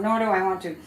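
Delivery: ordinary delivery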